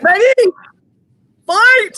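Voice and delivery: high, loud shout of Fight; booming fighting game announcer. Acted. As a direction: yell the round start like an arcade announcer. Host starts the battle.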